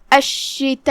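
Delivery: الشتاء with doubled sh and long a held four counts; الشتاء with madd wajib muttasil. Shown as a demonstration. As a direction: double the sh and stretch the a four beats. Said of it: "ہم اپنی آواز چار مد کے برابر لمبی کرتے ہیں".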